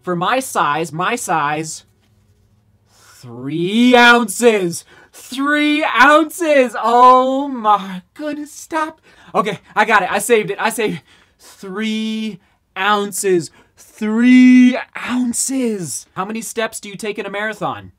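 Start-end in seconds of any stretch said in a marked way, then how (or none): 2.9-6.8 loud, high, thrilled disbelief; excited shout. Real, not acted. Runner shouting the new shoe's weight, thrilled. 6.8-9.0 slow, loud, high oh my goodness; overwhelmed gasp. Real, not acted. Runner overwhelmed by the light shoe, loud awe. 11.4-16.1 growing loud amazement; excited. Real, not acted. Runner repeating the weight, rising to an excited shout.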